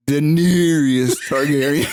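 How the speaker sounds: slow, drawn-out grand delivery; dramatic name drawl. Acted. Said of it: "It's just the way that you said it".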